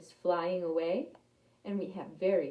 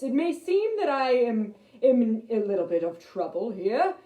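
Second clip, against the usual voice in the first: louder, proud lion voice; boastful trapped lion; 3 semitones higher, 8 dB louder than usual. Acted. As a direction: brag to the mouse despite the net. Lion voice addresses mouse.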